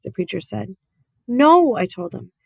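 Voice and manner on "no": sharp, high, loud no; flat refusal. Acted. Opal to the preacher, refusing to leave, sharp and loud. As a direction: dig in and refuse.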